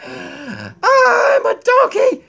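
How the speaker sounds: dramatic outcry. Pretend.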